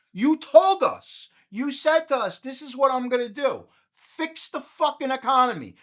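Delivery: loud, high, furious rant at the president; angry rant. Real, not acted. Comic ranting at Trump about the economy, furious and loud. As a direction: yell it at Trump like he owes you.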